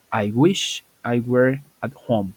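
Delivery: slow, carefully spaced English model line; slow model sentence. Shown as a demonstration. Teacher modeling English for Spanish-speaking students, slow and clear. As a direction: spell out "I wish I were at home" word by word, slowly. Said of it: "Y digo".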